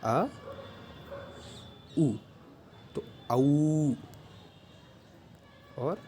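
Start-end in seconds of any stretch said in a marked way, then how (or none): 0.1-4.0 आ and उ apart, then औ very slow and drawn out; au built from a plus u. Shown as a demonstration. Teacher building औ from its two vowels. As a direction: blend a and u into a long gliding औ. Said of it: "ये भी किससे बन के मिला है".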